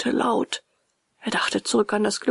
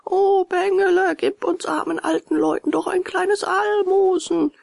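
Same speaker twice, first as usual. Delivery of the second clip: whining plea in a raised voice; wailing fox; 11 semitones higher, 4 dB louder than usual. A narrator's reading. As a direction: howl it like a pitiful beggar. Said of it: "fing der Fuchs mit heulender Stimme an".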